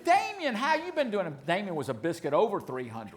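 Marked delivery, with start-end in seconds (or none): 0.0-1.3 much higher, louder mock woman's greeting; gushing lady banker. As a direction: gush over him like a long-lost friend. Banker greets the uncle too.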